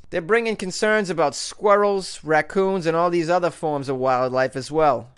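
normal talking voice

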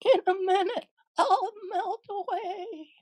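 very high wail, slowing and fading; screaming witch. Acted. The witch warns that she is melting, wailing. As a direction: wail it tearfully, drawing out the end. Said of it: "she screamed".

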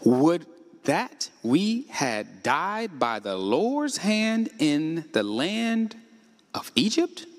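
very slow, heavy scripture read fading to a whisper; drawn-out reading ending breathless; dramatic slow reading. Shown as a demonstration. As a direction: stretch each word out for weight. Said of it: "watch these words, y'all".

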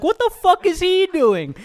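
very high panicked cry; freaking out. Retold or imitated. Watching the man take the money, panicked and very high. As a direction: squeal it in total panic. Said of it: "I was freaking out".

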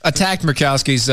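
ordinary talk

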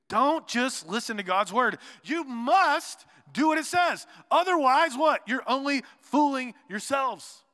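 loud, high, slow scripture declamation; forceful preaching. Acted. Preacher quoting James to the congregation, forceful and emphatic. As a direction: drive each word home to the congregation.

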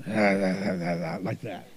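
a hint of a gruff lady's low voice, with a laugh; laugh, then drop low for the tough coworker; lightly voiced low gruff woman; deep voice. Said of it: "She even had a deep voice".

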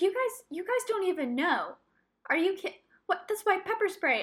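high-pitched, exasperated retort to security; indignant protest. Retold or imitated. Retold argument with security officer.

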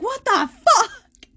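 high, loud laughing shriek of disbelief; shocked shriek. Retold or imitated. Patient finds blood on her gown, shrieks in laughing shock. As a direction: squeal it out, half laughing in shock. Waking to find blood everywhere.

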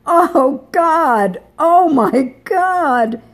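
high, loud, drawn-out cry of amazement; cry of delight. A narrator's reading. Woman in the shower crying out in high loud amazement. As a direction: let it ring out, overwhelmed. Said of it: "Diana's cry rang from the bathroom".

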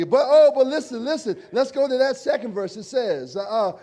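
ordinary talk, nothing marked

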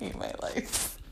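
breathy whispered lament; whispering. Real, not acted. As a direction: breathe it out, spent from laughing. Whispered after laughing fit.

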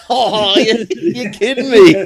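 high, loud mock dismay with a laugh; laughing disbelief. Tourist learning the seller takes cards, laughing mock disbelief.

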